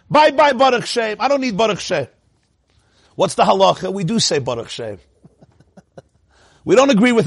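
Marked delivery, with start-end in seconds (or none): 0.1-2.1 mock high-pitched farewell; dismissive. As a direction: wave it away in a high, flippant voice. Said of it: "So Anshe Yericho said".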